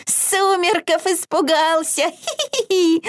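high squealing piggy taunt; trickster spirit. A narrator's reading. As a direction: giggle and jeer like a naughty piglet. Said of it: "хрюкал Изюмка".

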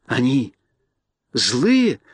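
plain reading voice

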